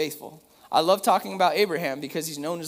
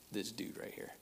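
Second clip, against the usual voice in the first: hushed whisper; 5 semitones lower, 16 dB quieter than usual. Retold or imitated. Tender aside about his son.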